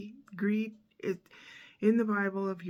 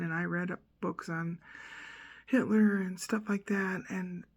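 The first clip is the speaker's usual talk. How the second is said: ordinary delivery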